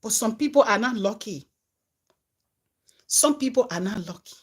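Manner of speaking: ordinary talk, nothing marked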